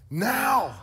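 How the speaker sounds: sudden loud high shout of NOW; preacher's exclamation; shouted answer. Real, not acted. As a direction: burst out with the answer. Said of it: "answers own question emphatically".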